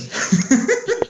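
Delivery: sudden high laugh after a fall; burst of laughter. Real, not acted. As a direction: laugh out in surprise. Falls off chair, laughs.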